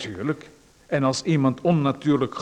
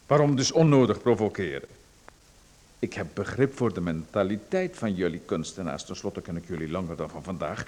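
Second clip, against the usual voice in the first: ordinary talk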